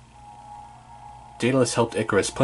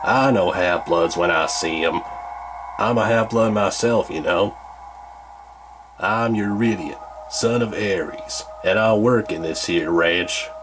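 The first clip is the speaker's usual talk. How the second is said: put-on southern accent; 2 semitones lower than usual. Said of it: "in a southern accent".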